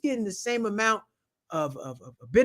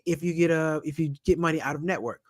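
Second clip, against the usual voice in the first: ordinary talk, nothing marked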